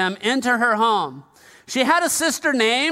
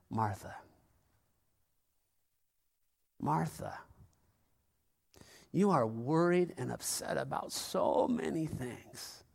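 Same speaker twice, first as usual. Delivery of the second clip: much softer and lower, almost a murmur; hushed Jesus; 10 semitones lower, 13 dB quieter than usual. Shown as a demonstration. Jesus quietly telling Martha she worries too much, far softer. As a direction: say it like a tender rebuke under the breath. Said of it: "Or was it".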